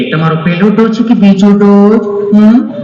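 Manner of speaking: high, sweetly solicitous nurse questioning; affectionate nurse. Acted. Nurse asking a vaccine patient about doses, affectionately. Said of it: "તમે કેટલું પ્રેમથી પૂછો છો".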